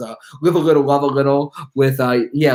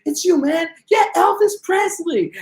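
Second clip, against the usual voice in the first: very high, slow excited voice; thrilled fan; slower than usual. Retold or imitated. Fan recognizing [name] in the donut shop, very high thrill. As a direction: squeal it like a dazzled fan. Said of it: "he goes like".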